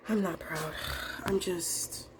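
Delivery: barely whispered, breaking off. Real, not acted. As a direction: murmur it to yourself, defeated. Sad self-talk, whispered aside.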